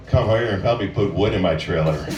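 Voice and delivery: low come-on voice; sexy tone. Shown as a demonstration. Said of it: "make it sound sexy by the tone of your voice".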